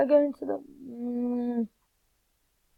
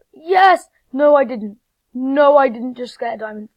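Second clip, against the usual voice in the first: real gamer excitement, raised; excited shout; 10 dB louder than usual. Real, not acted. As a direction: cheer at the find. Gets a diamond.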